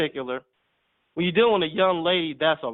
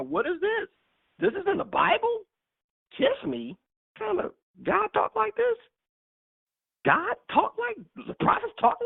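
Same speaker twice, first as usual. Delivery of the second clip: put-on high, squeaky, disbelieving kid voice; lightly mocking young man, pitched way up; scandalized son; 6 semitones higher than usual. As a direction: play the shocked boy squeaking at the Bible verse. Voicing shocked son reading Bible.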